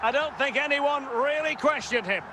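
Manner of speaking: excited football commentator; excited commentary. Pretend. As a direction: call the penalty with rising excitement. Commentator calling a penalty goal.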